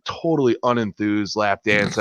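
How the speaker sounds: ordinary talk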